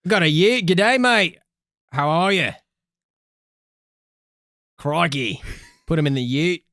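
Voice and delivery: broad mock Aussie slang; Australian accent. Host riffing on Australian slang in a broad accent. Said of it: "[name]'s Australian Slang".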